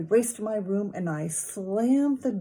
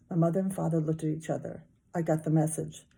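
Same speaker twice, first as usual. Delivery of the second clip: usual speaking voice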